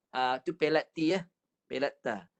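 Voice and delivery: normal talking voice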